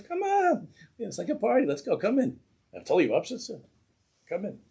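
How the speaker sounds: high, loud, gleeful party welcome; laughing welcome. Retold or imitated. Heavenly court laughing as they welcome the chassid in, gleeful. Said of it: "they all start to laugh".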